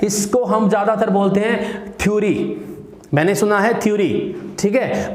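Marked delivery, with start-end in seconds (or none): 2.0-4.4 "थ्योरी" as THYU-ree, two syllables; "थ्योरी" the everyday wrong way; common mispronunciation. Shown as a demonstration. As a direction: run "थ्योरी" together as thyoo-ree. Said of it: "but this is not".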